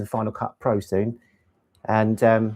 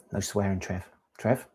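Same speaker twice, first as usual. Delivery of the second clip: ordinary talk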